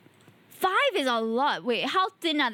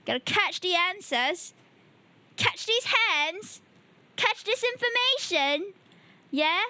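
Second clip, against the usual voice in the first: high-pitched, slipping into British; accidental British accent; 5 semitones higher, slower than usual. Real, not acted. Streamer urging chat to catch the answers, drifting into a British accent. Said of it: "Why am I turning British?".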